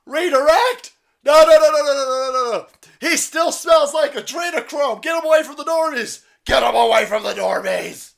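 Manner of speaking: high, loud frantic yelling orders; hammed-up screaming security detail; mock panicked shouting agent.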